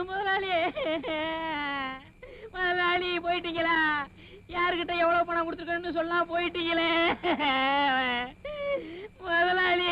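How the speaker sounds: high, drawn-out mourning wail. Acted. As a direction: cry out in grief for your dead boss. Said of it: "ஏன்டா அழுவுற?". (முதலாளிக்காக ஒப்பாரி வைத்து அழுகை)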